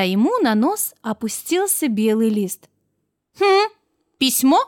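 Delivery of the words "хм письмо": high, puzzled hum and rising question; storybook snowman taken by surprise; surprised. A narrator's reading. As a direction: wonder aloud at an unexpected find. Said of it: "удивился он".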